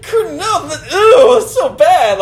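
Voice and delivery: high laughing squeal of disgust; mock disgust. Imitating Morgan, grossed out by the grope, laughing and squealing. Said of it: "It's like".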